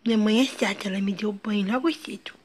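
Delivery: soft whispered pouting complaint; whispered child voice. Pretend. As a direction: whisper it like a sulky little girl telling a secret. Mãe sussurra como a filha. (sussurro manhoso de criança)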